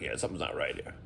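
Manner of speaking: whispered low and quiet; hushed aside. Retold or imitated. Plumber quietly telling the branch manager something is off, whispered. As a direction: let it out under your breath.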